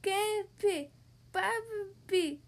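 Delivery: put-on high, babyish garbled call; toddler shout. A narrator's reading. Little George greeting grandparents, high babyish mild shout. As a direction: copy big sister in baby talk. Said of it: "shouted George".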